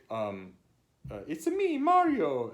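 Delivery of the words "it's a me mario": high, loud cartoon Italian accent; exaggerated Italian accent, pitched way up; Mario. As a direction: sing out a mock thick Italian accent. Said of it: "in a very thick".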